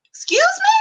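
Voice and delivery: very high squeak of disbelief; retold affronted surprise. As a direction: act out being taken aback. Retold shocked comeback.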